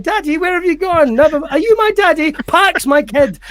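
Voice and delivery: put-on high-pitched pleading kid voice; mock-forlorn little child; plaintive child. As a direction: call out for Daddy, high and hammed-up.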